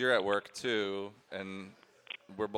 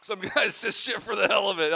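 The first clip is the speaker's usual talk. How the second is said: mocking echo; 11 semitones higher, 5 dB louder than usual. Real, not acted. Mocking echo of her line.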